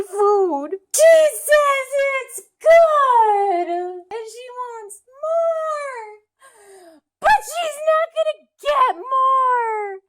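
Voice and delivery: weepy, stretched-out mock lament; hammed-up bawling. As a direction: tell it through exaggerated sobs. Bawls through the retelling.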